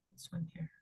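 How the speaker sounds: very soft, whispered to oneself; barely audible self-talk; whispered aside. Real, not acted. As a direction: whisper it under your breath while finding the material. Muttering aside while setting up lesson.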